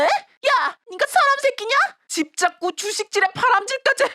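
high, angry scolding of her daughter; furious yelling. Acted. Mother berating her lying daughter, high furious yell. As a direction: tear into her, pitched high.

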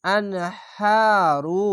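very slow intoned আনহারু with a long drawn vowel; sung Arabic recitation; Quran recitation. Shown as a demonstration. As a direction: stretch the ha in আনহারু one alif long, slowly. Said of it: "খাড়া জবর এক আলিফ টেনে পড়বেন".

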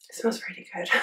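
breathy whispered aside; whispering. Real, not acted. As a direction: whisper it to yourself. Sniffing foundation, aside.

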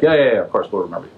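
quick, dismissive imitated reassurance; glib promise. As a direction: rattle off an empty promise. Prisoners brush off Joseph's request.

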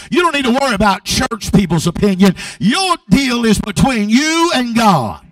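loud, insistent sermon delivery; full-force preaching. Real, not acted. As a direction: build from firm telling into forceful preaching.

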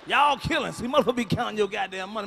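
ordinary talk

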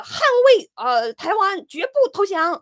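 mocking slogan shout; mock battle cry. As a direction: ham up a patriotic war cry. Mocking switch people flipping.

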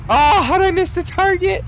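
high, surprised yelp of disbelief; incredulous high yelp after a missed shot; shocked squeal. Real, not acted. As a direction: squeal it like you can't believe the miss.

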